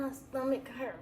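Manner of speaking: ordinary talk, nothing marked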